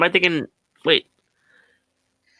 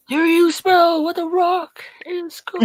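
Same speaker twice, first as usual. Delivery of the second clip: much higher, badly faked Rock catchphrase; The Rock impression; 11 semitones higher than usual. Friend calls it the worst Rock accent heard. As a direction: ham up the wrestling promo. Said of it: "worst Rock accent I've ever heard".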